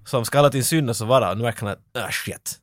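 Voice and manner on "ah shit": voiceless, very soft mutter; whispered curse. Retold or imitated. Bodyguard realising what he took on, whispered curse. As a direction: breathe it out as an acted realisation.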